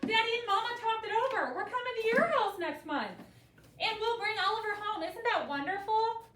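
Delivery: high and bright-eyed, bubbling over with news; excited letter. Acted. Teacher reading a girl's letter to her uncle, excited. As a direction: read it like a thrilled kid sharing big news. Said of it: "She was excited".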